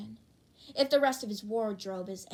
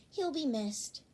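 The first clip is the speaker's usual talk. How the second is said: ordinary talk, nothing marked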